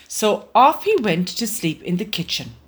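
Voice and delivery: ordinary delivery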